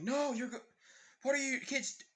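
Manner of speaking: high-pitched alarm, cut off mid-word; acted panic, pitch jumping up; alarmed protest. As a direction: cry out in alarm at the kids. Alarmed cry cut off mid-sentence.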